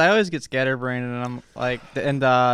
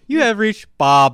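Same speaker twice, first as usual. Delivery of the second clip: slow, loud, higher recorded greeting; voicemail greeting voice; 7 semitones higher, 11 dB louder, slower than usual. Pretend. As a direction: announce it like a canned phone recording.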